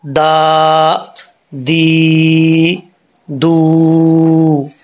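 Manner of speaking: ता, ती, तू each long and sustained; drawn-out Quranic syllables. Shown as a demonstration. Teacher demonstrating the vowel sounds to students, long sustained recitation. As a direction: chant ता ती तू slowly with long vowels. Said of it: "अब इसका sound क्या आएगा?".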